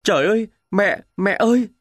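desperate call for his mother; wounded cry. A narrator's reading. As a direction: cry out in agony. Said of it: "có tiếng kêu".

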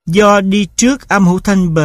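ordinary delivery

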